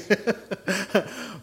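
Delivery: a burst of real laughter; laughing at his own slip. Real, not acted. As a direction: laugh at yourself.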